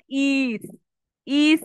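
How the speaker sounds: "ইস" with a clear ee before the s; wrong added vowel. Shown as a demonstration. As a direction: lead into the s with an ee, as the mistake. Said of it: "ই আসবে না প্রথমে". ("ইস", স এর আগে ভুল করে ই বসিয়ে)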